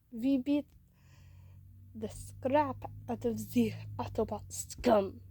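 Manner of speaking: put-on loud, slow accented boast; hot-headed character, hammered stresses; hot-headed robot. A narrator's reading. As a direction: punch out the words in a put-on villain voice.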